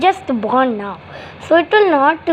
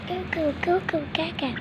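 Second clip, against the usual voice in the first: put-on cooing baby; 11 dB quieter than usual. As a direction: coo like a pretend newborn. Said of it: "just say goo goo gaga".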